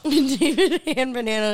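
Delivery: repeating it through real laughter; laughing. Real, not acted. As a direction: laugh out the nickname. Repeats the nickname while laughing.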